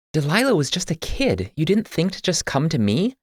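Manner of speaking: put-on high, urgent young woman's voice, demanding an answer; Andrea pressing hard. Andrea demands why Y never came to her, urgent and high. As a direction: press him like a wronged sister. Andrea confronts Y about sister.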